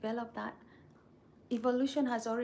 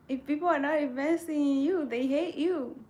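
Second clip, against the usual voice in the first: louder and higher, driving the point home; emphatic restatement; 5 semitones higher, 7 dB louder than usual. Real, not acted. Restating the viewer's point to the audience, loud and emphatic. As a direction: say it loud like a punchline you believe.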